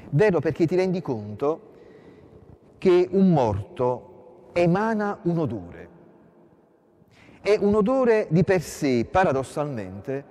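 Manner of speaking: fervent preaching. Real, not acted. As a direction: preach it out to the congregation with growing force. Preacher builds to an emphatic point. (predica sempre più accesa, più forte e più alta)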